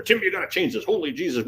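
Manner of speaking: high-pitched retold protest; alarmed boss. Boss pushing back on the risk report, alarmed. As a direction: lightly act out a panicked superior.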